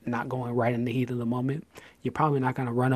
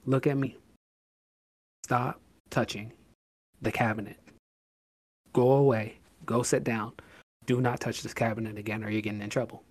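calm firm parent. Retold or imitated. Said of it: "be like".

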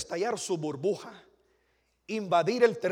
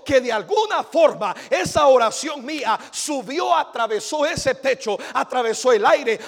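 louder, higher, fervent sermon drive; testimony preaching; 7 semitones higher, 13 dB louder than usual. Acted. Preacher telling the church how he prayed, fervent. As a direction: preach it with mounting joy.

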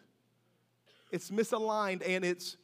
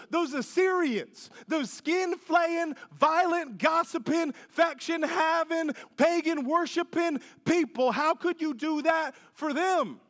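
higher, pleading complaint to God; whining Jonah; 6 semitones higher than usual. Retold or imitated. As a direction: whine at God like a sulking prophet. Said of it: "He's like".

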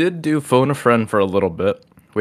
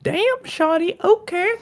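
squeaky high echo; slower than usual. Pretend. Echoing partner's exclamation.

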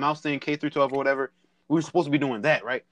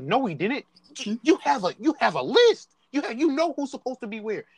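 teacher; 8 semitones higher than usual. Retold or imitated. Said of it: "She was like".

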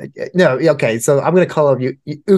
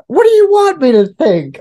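high, loud exasperated plea; mock exasperation; 12 semitones higher, 5 dB louder than usual. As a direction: throw up your hands, much higher and louder. Russian diplomat exasperated.

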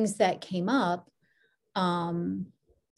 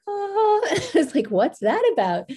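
high, bright surprised exclamation with a laugh; 12 semitones higher, 6 dB louder than usual. Retold or imitated. Recalling the bookstore find, curiously surprised. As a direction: perk up, curious and amused. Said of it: "And I was like".

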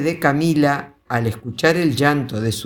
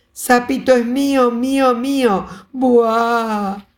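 put-on toddler crying tantrum; 10 semitones higher than usual. A narrator's reading. Little girl squeezing the toad, high crying tantrum. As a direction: wail and sob like a toddler. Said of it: "además de llorar".

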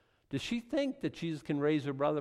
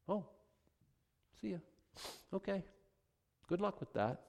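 soft mock dismissal; 8 semitones higher, 7 dB quieter than usual. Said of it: "Jesus dismissing".

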